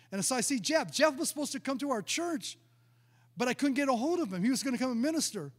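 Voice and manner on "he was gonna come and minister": ordinary delivery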